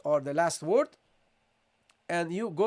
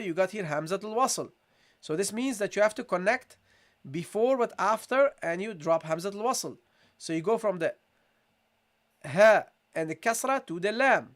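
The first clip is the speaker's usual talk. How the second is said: ordinary talk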